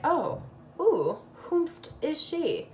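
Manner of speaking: high, slow, drawn-out "whomst is she"; mock admirer. Vlogger voicing an admirer impressed by her blush, mock posh. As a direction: play an impressed onlooker, lightly.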